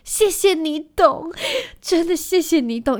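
mock teary thanks. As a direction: pretend to choke up with teary thanks. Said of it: "眼眶都含泪的看着他说". (假装含泪、慢慢地道谢)

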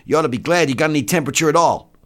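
quick, high soldier's voice; enlisted man chiding. Acted. Enlisted man telling doctor to be glad, quick and high. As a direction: rattle it off, pitched high.

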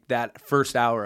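usual speaking voice

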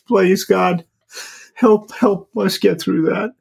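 high, choked-up voice on the edge of tears; fighting back tears while talking; voice breaking. Real, not acted. Father telling the host about his daughters, voice breaking.

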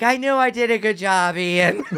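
high, proud mom voice; lightly voiced proud mother. Voicing his mom.

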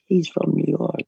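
mock high-pitched quote of his buddy. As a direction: squeak it out like a silly imitation. Mocking a friend's remark.

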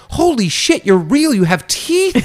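high, amazed disbelief; awestruck. Retold or imitated. To Guy Fieri, starstruck high amazed cry. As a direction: gasp it out like a cartoon came alive.

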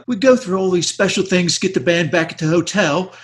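high mock Cockney, laughing; hammy Cockney impression; Cockney accent. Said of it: "he's got this real Cockney accent".

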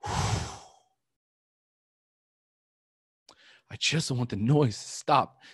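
really tearful, halting after a long breath; crying. Real, not acted. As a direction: push the words out through tears. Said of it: "I was crying just like I am right now".

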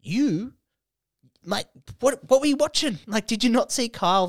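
high, loud mock disbelief; comically outraged questioning; incredulous protest. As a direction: challenge them in high-pitched bafflement.

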